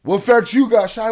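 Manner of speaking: loud sneering parrot of a viewer; mocking chatter. Streamer mimicking a viewer's challenge, loud mock voice. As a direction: throw the viewer's question back mockingly. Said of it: "he wanna tell me the same shit".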